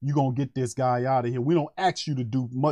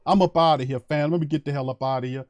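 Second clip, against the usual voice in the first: ordinary talk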